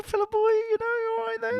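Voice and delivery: high, sing-song mock greeting; a high squeaky hello to the new arrival. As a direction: chirp it at the guest like a pet. Greeting Aussie guest mockingly.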